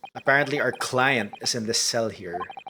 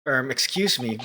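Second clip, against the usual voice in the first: ordinary delivery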